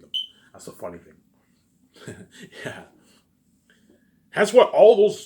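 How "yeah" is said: normal talking voice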